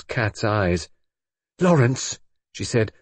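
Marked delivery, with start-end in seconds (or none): 1.6-2.2 raised, sudden alarmed call; alarmed cry. A narrator's reading. Brenda staring at the inspector, alarmed. Arrested woman demands after her lover.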